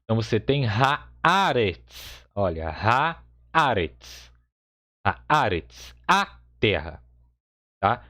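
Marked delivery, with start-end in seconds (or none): 0.7-2.3 haaretz with a long open "aa", drawn out slowly; changed first vowel. Shown as a demonstration. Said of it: "a primeira sílaba da palavra muda". 2.7-5.9 haaretz slowly, with a long open "aa"; changed first vowel. Shown as a demonstration. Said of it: "a primeira sílaba da palavra muda".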